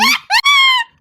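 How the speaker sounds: very high, loud burst of laughter; loud laughing shriek. Real, not acted. Cracking up over a clown joke.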